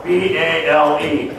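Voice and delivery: slow, deliberate spelling of B A L E; each letter of B A L E said apart and slow; spelled out. Shown as a demonstration. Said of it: "anybody that's thrown a few thousand bales is gonna know".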